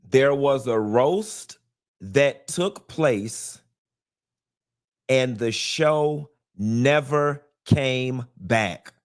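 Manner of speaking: measured delivery with pauses between phrases; slow, deliberate restatement. Real, not acted. Host restating that the show never came back, slow and deliberate. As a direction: spell it out slowly, word by word. Restates the same point more slowly.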